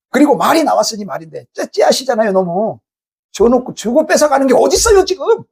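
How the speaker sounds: rising into a high-pitched protest; acted-out grumble that climbs to indignation; indignant complaint. Believer griping at God over a gift taken back, indignant. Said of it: "하나님께 이렇게 눈에 뵈는 게 없어가지고".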